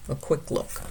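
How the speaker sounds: ordinary delivery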